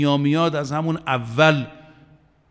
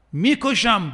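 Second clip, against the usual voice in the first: higher, louder threat; Pharaoh threatening; 6 semitones higher, 4 dB louder, slower than usual. Retold or imitated. Preacher voicing Pharaoh threatening to kill them. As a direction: bark the threat as a tyrant. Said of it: "فرعون میگه".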